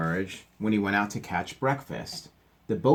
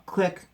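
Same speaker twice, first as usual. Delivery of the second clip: camera click; 10 semitones higher than usual. A narrator's reading. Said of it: "said the camera".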